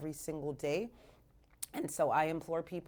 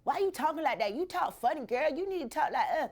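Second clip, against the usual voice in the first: put-on high taunting kids' voice; high mocking schoolyard taunt; mocking kids; 8 semitones higher than usual. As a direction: jeer like schoolyard bullies. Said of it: "They're like".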